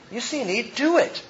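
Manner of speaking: normal talking voice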